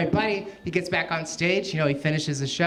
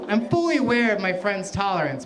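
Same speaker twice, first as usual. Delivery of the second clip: ordinary talk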